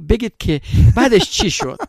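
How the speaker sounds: high, loud, anxious begging for the rest of the story; worried pleading. Retold or imitated. Relative calling the storyteller midweek, worried and pleading. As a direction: plead like someone who can't wait to hear what happened. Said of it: "این نگران بود".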